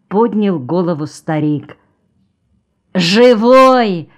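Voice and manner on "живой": loud, high, drawn-out yell. A narrator's reading. Аникей into the old man's ear, yelling loudly. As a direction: bellow it into his ear. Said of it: "заорал ему в ухо Аникей".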